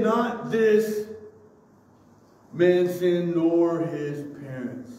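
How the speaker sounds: slow, weighted "Not this", pause, then "man sinned, nor his parents"; emphatic negation. Retold or imitated. Preacher quoting Jesus' denial, emphatic stress on "Not this". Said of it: "it's emphatic".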